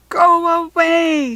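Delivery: slow, high, very loud roar; fierce cub roar. A narrator's reading. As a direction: roar them away like tiger cubs. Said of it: "roared in unison".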